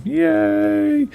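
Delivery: a quick high-pitched yay of delight; excited cheer. Real, not acted. Cheering the game's return.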